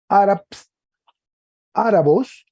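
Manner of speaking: Árabs, árabos pronounced high and loud; teacher sounding out árabos carefully; Greek declension read out. Shown as a demonstration. Teacher demonstrates Greek nouns to class, emphatic. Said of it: "Que trae un espíritu suave".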